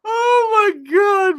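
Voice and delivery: high shrieking oh my God while laughing; laughing shout. Real, not acted. Reacting to a chat comment, shrieking with laughter. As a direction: burst out in disbelieving laughter.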